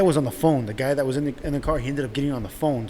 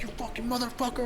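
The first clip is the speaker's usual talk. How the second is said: high, held-down mock yell; mock angry dad; 9 semitones higher, 9 dB quieter than usual. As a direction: imitate a raging dad at reduced volume. Retelling dad cursing driver.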